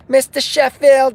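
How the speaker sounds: high, loud, drawn-out call; joke imitation of wife. Wife calling her husband, high joking imitation. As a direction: call him slowly in a mock high voice. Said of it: "She doesn't call me Mister Sheffield".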